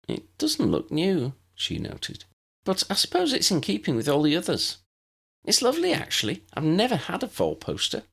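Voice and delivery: a hint of a polite first-year; Lydia the new girl. Lydia voiced in the story.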